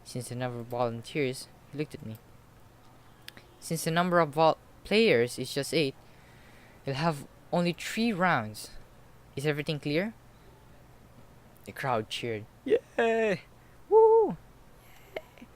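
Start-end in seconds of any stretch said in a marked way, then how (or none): 3.6-10.1 put-on nerdy boy voice. Shown as a demonstration. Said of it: "repeats line in character voice". 12.7-15.5 cheering crowd. Acted.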